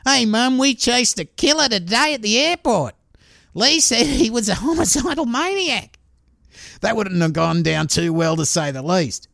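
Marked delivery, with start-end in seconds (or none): 0.0-6.0 put-on higher, childlike telling-on voice; mild kid voice tattling; imitating excited kid. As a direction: play a little kid blurting to mum. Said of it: "going home saying".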